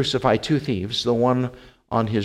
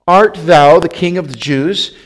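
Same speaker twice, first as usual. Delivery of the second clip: loud, raised questioning as Pilate; interrogating; 4 semitones higher, 8 dB louder than usual. A narrator's reading. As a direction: demand an answer, voice lifted. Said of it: "And Pilate asked him".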